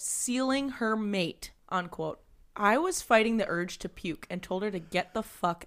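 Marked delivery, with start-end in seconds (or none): 0.0-1.5 slow, deliberate quote. Real, not acted. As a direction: say it slowly like a quote.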